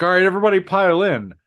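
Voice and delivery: imitated boss calling out loudly; herding supervisor. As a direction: call the whole group inside. Supervisor herds staff into office.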